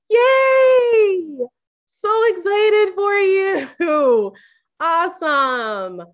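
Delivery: high, loud, slow fake cheer; hammed-up delighted yay. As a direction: squeal it over-bright, hiding the anger. Fake cheer at friend's news.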